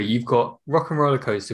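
normal talking voice

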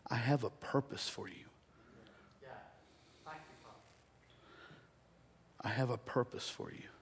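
soft, low voice of God; hushed, tender assurance; gentle reassurance. Acted. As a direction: say it quietly, as a solemn promise. Voices God's reassurance, repeated softly.

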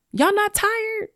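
high incredulous question; can't believe they aren't tired. Real, not acted. Podcaster asking listeners, incredulously high. Challenging the audience.

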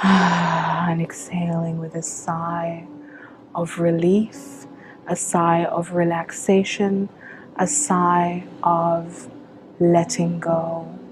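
calm meditation guide with long pauses; unhurried, measured visualization voice; guided meditation. Acted. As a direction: lead them into relaxation, phrase by phrase.

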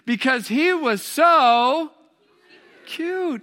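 put-on high-pitched girlish answer, drawn out on "so"; imitating swooning girls. Junior high girls answering why he won, high and gushing. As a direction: mimic a table of girls, stretching it out high. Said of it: "they all answered in unison".

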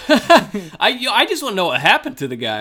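talking through loud laughter; words bursting out between laughs; laughing. Real, not acted. Laughing while asking about thief.

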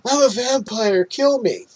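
high mock plea to strangers; lightly voiced desperate man. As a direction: beg them to end it, hammed-up. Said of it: "tells the couple walking by".

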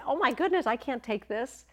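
high, loud retold amazement; astonished protest. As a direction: gush in overwhelmed refusal. Retells protesting a lavish gift.

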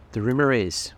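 plain reading voice

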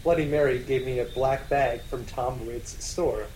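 ordinary talk, nothing marked

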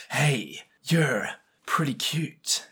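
slow, whispered pickup line; breathy whisper. Pretend. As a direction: whisper it slowly, like a flirt. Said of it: "by walking up to them and going".

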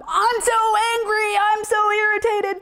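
high, hammed-up whine of complaint; lightly voiced griping, pitched high; mock angry psalmist. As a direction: mimic a moaning psalm. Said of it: "it went from".

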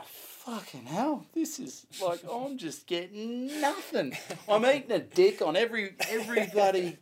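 high, strained comic despair; exasperated. Retold or imitated. Comic reliving three days of bombing, hammed-up exasperation. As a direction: play up the frustration of a bombing comic.

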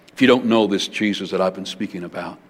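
normal talking voice

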